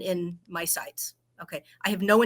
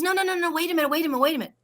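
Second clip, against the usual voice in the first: much higher, urgent friend's voice; insistent friend; 9 semitones higher, much faster than usual. Retold or imitated. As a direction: stop her with high insistence. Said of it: "she goes".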